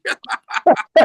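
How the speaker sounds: loud breathy laugh at own punchline; real loud laughing fit; burst of laughter. Real, not acted. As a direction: crack up at the joke. Laughing at his own joke.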